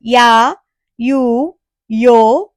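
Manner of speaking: യാ, യു, യോ said clearly, one at a time; teacher drilling the ya row; ya row read aloud. Shown as a demonstration. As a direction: read the three ya syllables. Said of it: "യായിൽ ആകെ മൂന്നെണ്ണം ഉള്ളൂ".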